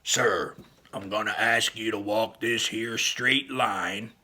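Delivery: slow, loud official command; police officer. Acted. Officer testing Dad, slow authoritative cop voice. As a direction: give a deliberate cop's instruction. Officer giving a sobriety test.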